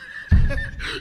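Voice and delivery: a sharp, high burst of scornful laughter; mocking high laugh. Real, not acted. Scoffing at a Zoom meeting for poor people, with a high laugh.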